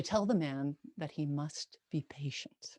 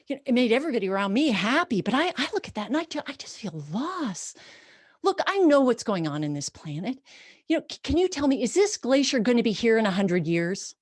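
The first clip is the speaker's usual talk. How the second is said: loud, fast, higher rush of grief; anguished outpouring; 6 semitones higher, 6 dB louder, faster than usual. Retold or imitated. Woman pleading with the ranger about the glacier's fate, urgent. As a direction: pour out the worry. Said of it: "she says".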